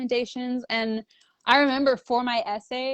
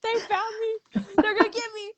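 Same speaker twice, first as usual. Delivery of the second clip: mock panic; 11 semitones higher than usual. Mock panic at government call.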